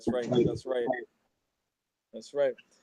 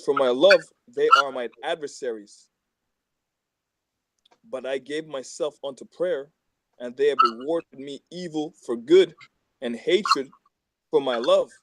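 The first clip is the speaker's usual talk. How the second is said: louder, deliberate psalm reading; scripture reading; 5 dB louder than usual. Acted. Reading Psalm 109 aloud to friends, in a proclaiming voice. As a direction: proclaim the verse to the group.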